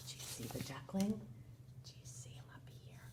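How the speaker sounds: put-on whispered question to young children; barely audible, voiceless whisper; hushed whisper. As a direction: whisper it like a secret search. Hushed searching with young listeners.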